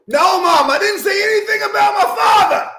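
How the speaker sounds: high-pitched yell at mom; shouting. Acted. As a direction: shout it across the house. Kid shouting to his mom offscreen.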